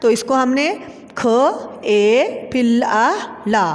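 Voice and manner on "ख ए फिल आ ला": slow, drawn letter-by-letter spelling. Shown as a demonstration. Teacher spelling a word for pupils, slow and drawn out. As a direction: sound out each letter slowly with long gaps. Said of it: "खेला".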